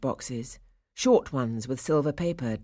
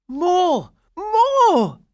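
high, loud clamour, second one higher; crying out; 10 dB louder than usual. A narrator's reading. Boys demanding more after the clockwork dolls, crying out. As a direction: shout for it greedily in a child's pitch. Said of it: "the boys cried out".

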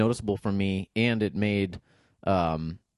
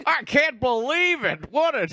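mock disbelief; 12 semitones higher, 3 dB louder, slower than usual. Mock shock at the disaster.

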